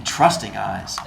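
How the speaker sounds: ordinary delivery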